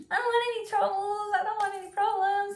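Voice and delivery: high, fast, nervous plea to back off; a scared worker begging a stranger not to start trouble; nervous pleading. Retold or imitated. Short worker pleads with a yelling stranger, high and rushed. Backing away from yelling woman.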